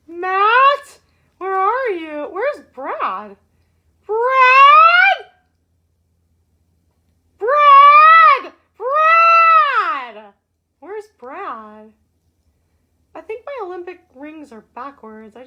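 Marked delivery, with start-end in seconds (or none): 4.1-10.3 higher, louder calls of a name; calling out. Pretend. Host shouting for Brad who isn't there, loud playful calls. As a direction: shout the name across the house.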